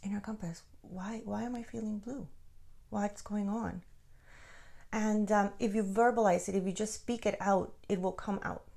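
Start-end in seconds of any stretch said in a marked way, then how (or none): 0.0-3.8 soft, hushed ask to yourself; hushed question. Shown as a demonstration. Teacher showing how to ask your inner compass softly. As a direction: ask it quietly, turning inward. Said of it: "You can connect and be like".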